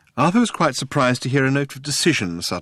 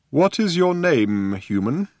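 put-on slow, higher, grave old man; 7 semitones higher, slower than usual. A narrator's reading. Old man asking Arthur's name, slow and grave. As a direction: weigh each word.

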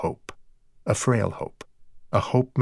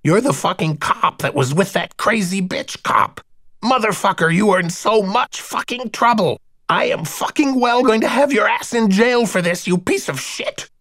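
much higher, louder, rasping tirade; raspy bold threats; 12 semitones higher, 4 dB louder than usual. A narrator's reading. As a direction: spit threats through a crushed throat. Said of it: "ratchets on in his newly raspy voice".

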